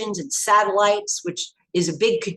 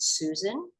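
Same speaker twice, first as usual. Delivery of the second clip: hushed warning; 3 semitones lower, 6 dB quieter than usual. Retold or imitated. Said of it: "he said".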